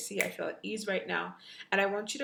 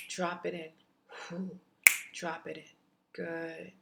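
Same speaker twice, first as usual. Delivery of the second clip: guided meditation. Acted. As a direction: guide them gently into the memory.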